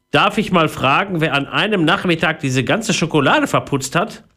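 lightly voiced stern mother asking; a hint of a mother demanding answers. Mother questioning her son about the chocolate, mildly stern.

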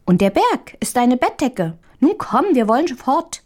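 high, lightly voiced little mouse; delighted piping mouse. A narrator's reading. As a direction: raise the pitch, a cheerful mouse urging her along. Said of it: "piepte vor Vergnügen".

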